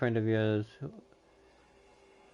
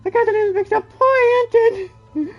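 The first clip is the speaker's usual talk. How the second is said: much higher, much louder, slow laughing wail that cracks into crying; hysterical laughing through tears; 14 dB louder, much slower than usual. Acted. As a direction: laugh and sob at once over poor Anton. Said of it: "I shouldn't be laughing".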